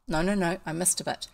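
plain reading voice

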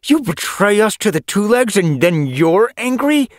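high, loud accusing outburst; angry. Acted. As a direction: hurl the accusation at her. Angry quarrel with traitor.

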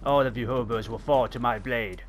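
low, soft ominous vow; dark, understated warrior's threat; mock menacing threat. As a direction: threaten them in a low, hushed villain tone. Mock threat to game enemies.

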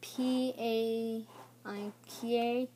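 P A I K slow, each part held long; sounding out. Shown as a demonstration. Child unsure of a card name, sounding it out. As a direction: stretch out P A I K uncertainly. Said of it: "I'll try and pronounce it".

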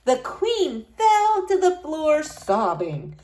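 ordinary delivery

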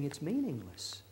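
ordinary talk

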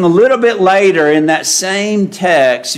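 ordinary talk, nothing marked

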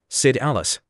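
ordinary delivery, nothing marked

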